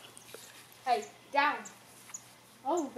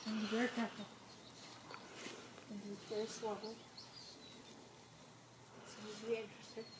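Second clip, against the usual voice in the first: very soft hushed warning; whispering; 3 semitones lower, 17 dB quieter, faster than usual. Real, not acted. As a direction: hush it so the dog stays calm. Hushed near the pug.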